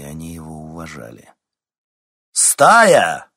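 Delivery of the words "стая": loud, high booming summons; thundering call. A narrator's reading. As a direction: call the pack like a dark lord. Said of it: "громогласно произнёс Константин".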